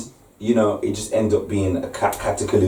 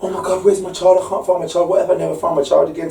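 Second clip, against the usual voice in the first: panicked parent; 8 semitones higher, faster than usual. Retold or imitated. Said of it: "parents are going through the trauma".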